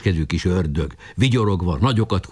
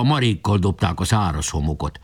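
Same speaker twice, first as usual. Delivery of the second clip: usual speaking voice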